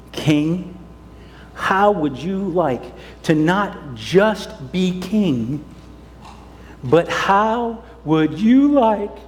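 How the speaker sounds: sly flattering leaders. Acted. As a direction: lure the king in slowly. Scheming leaders coax the king.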